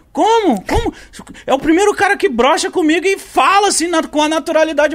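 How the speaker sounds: high, loud, incredulous woman's voice; woman in disbelief. Retold or imitated. Retelling how his date reacted, shocked and high. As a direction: play her shocked that he admits it. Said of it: "e a mina".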